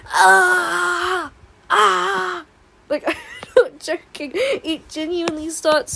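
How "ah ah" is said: high mock wailing cries; a silly imitation of a console screaming; imitating a crying Switch. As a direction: wail like a whining console, loud and high. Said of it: "my Switch starts going".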